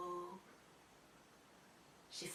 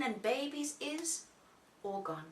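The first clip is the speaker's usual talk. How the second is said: ordinary delivery, nothing marked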